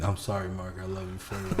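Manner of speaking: quiet. Pretend. Soft mock apology.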